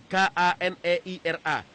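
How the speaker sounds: "K A N E I R A" spelled slowly, letter by letter. Retold or imitated. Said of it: "Dia bilang". ("K A N E I R A" dieja pelan huruf demi huruf)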